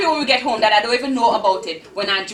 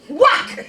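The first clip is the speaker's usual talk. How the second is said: sharp loud high whack of a belt; 12 semitones higher, 5 dB louder than usual. Acted. Aunt's belt strikes the girl, loud whack. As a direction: crack it out like leather hitting skin.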